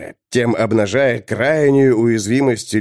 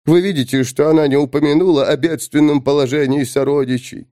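plain reading voice

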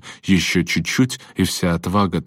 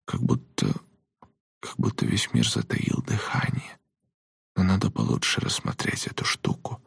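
hushed, halting whisper; 5 semitones higher, 6 dB quieter than usual. A narrator's reading. Boy tells his friend how eerily still it is, whispering. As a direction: whisper it softly, with pauses. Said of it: "прошептал Берти".

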